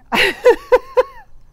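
loud, high cackling laugh in bursts; hearty laugh. Real, not acted. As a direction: crack up at being teased. Still amused by the teasing.

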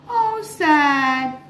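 high gasp, then how sad; mock sad gasp. Storyteller gasping sadly for the children. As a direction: gasp in pretend dismay for the kids.

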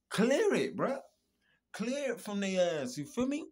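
loud, high fired-up shout; loud fired-up yell at the listener. Real, not acted. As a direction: yell it like you're fed up. Angry advice to cut off abusers.

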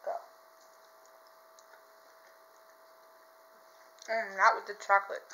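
talking while eating; chewing. Real, not acted. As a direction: mouth partly full of candy. Seen 0: Puts bean in mouth at -4, grimacing jaw movements follow.

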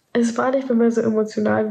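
usual speaking voice

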